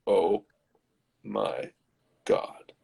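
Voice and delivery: low, halting mock shock; hushed, with a pause after each word; stunned. Young man seeing the crushed face, stunned low halting voice.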